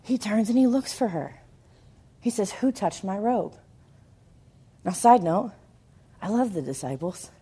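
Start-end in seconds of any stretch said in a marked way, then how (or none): 2.5-3.5 much softer, searching; quiet question. Acted. Jesus in the crowd quietly asking who touched him. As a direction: ask it gently under the breath.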